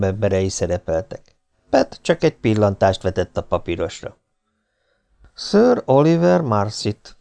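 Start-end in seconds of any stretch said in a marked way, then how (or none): 5.3-7.2 slow, higher, spelling out "Sir Oliver Marsit" from the list; reading aloud. A narrator's reading. Pet reads a name from the list, slow and deliberate. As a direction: read the name off the paper deliberately. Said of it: "olvasta hangosan".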